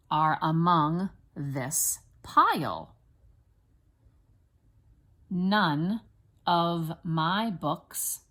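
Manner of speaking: slow, separated words with clear stresses; teacher's deliberate word-by-word reading; spelling dictation. Shown as a demonstration. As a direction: dictate it slowly for first graders to write. Teacher dictates for children to write.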